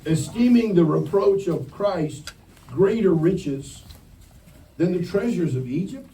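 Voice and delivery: slow, loud Bible reading; scripture reading. Acted. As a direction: read the verse slowly to the room.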